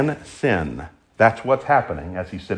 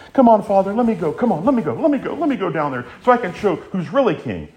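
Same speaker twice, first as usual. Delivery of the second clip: high-pitched, eager mock pleading; impatient begging; 8 semitones higher, faster than usual. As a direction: beg to be let go, repeating insistently. Jesus imagined impatient to rule.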